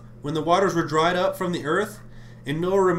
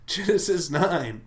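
ordinary talk, nothing marked

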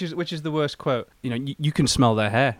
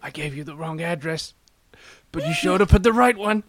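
accusing line that climbs into a laugh; lightly acted accusation; 4 semitones higher, 4 dB louder than usual. Film spoof about the wrong address, lightly acted accusation. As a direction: confront someone, rising louder and higher. Acting out a gangster film scene.

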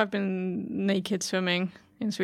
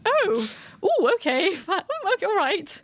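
high, startled dad stammering through it; a caught-off-guard dad, hiding his embarrassment with a laugh; flustered surprise; 11 semitones higher than usual. Retold or imitated. Dad realises the swim is naked.

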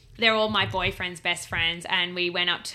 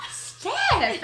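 "Steph!" called much higher, falling at the end; a high, drawn-out plea of "Steph!"; 13 semitones higher than usual. Retold or imitated. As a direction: narrator retelling her own shocked cry at Steph, high and falling. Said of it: "I was like".

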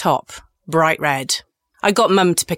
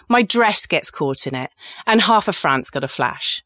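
normal talking voice

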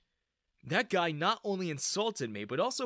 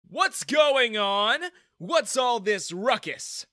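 loud, high, drawn-out demand; irate manager; 9 semitones higher, 8 dB louder, much slower than usual. A narrator's reading. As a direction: boss storming in on a row. Manager confronts noisy scene.

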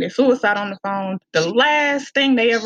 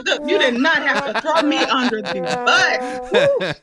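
laughing protest; 4 semitones higher than usual. Real, not acted. Host exposed her cursing habit.

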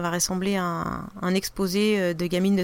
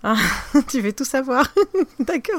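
laughing, higher-pitched reply; amused teasing; 6 semitones higher, 4 dB louder than usual. Real, not acted. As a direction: give in with a laugh. Playful reaction to nosy question.

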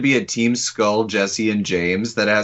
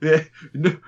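ordinary delivery

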